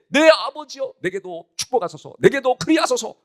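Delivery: high, wailing plea, acted out; tearful plea. Esau begs his father for a blessing, acted tearful plea. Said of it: "엉엉 울면서".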